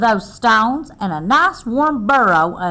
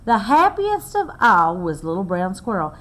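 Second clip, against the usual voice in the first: plain reading voice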